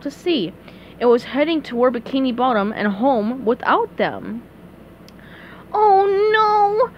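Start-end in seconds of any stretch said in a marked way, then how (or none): 5.7-6.9 high cartoon cry of dismay; SpongeBob crying out. A narrator's reading. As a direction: wail it out high and drawn-out. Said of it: "cried SpongeBob".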